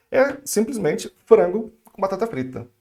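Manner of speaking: ordinary delivery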